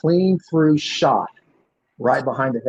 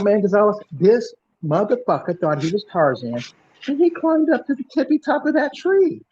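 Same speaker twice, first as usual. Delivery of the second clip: much higher, lively street retelling; a light imitation of an amped-up witness; excited bystander; 7 semitones higher than usual. Bystander voiced telling what happened.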